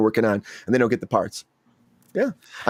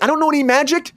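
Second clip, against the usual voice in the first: higher, mock-outraged protest; indignant complaint; 13 semitones higher than usual. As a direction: whine at the casting people. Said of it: "I'm like".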